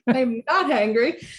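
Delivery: playful, laughing protest; joking that she's not hangry; laughing denial. Real, not acted. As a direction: giggle through the word hangry. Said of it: "I just said hangry".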